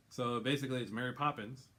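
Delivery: ordinary delivery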